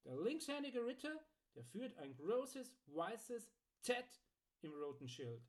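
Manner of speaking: high, slowing, deliberate proclamation; Archibald the customer. Acted.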